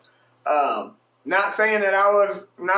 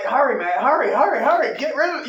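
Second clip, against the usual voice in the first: rising, frantic urging; urgent; 5 semitones higher, faster than usual. Retold or imitated. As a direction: rush them, getting higher. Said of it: "I was like".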